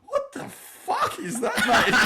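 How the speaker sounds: high, laughing shout of disbelief. Retold or imitated. Teammate baffled by the sledge, laughing shout. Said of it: "And Crackers goes".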